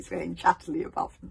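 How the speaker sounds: plain reading voice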